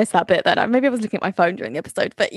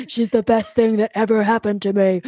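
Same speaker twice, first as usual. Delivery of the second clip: cold deadpan voice. Retold or imitated. Mocking Bart's flat sincerity.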